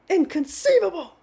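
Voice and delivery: high, loud hammed-up cry of Inconceivable; bad Princess Bride accent. Repeating the film catchphrase in the mock accent, high and loud. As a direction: belt it out in the silly movie accent. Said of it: "the worst accent ever to do".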